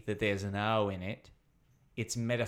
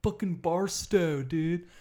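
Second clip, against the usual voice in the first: higher, stretched-out Californian dude voice; mock California accent; 5 semitones higher, 3 dB louder, slower than usual. Mimicking outsiders' idea of Americans, mock California dude accent. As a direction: overdo a California surfer accent. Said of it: "we don't sound like California".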